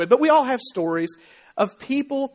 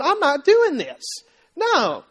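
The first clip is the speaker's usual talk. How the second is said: higher-pitched, drawn-out sulky refusal; pouting refusal; 5 semitones higher, slower than usual. Acted. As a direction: refuse like a kid with lip stuck out. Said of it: "they stick their bottom lip out".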